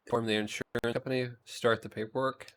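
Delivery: normal talking voice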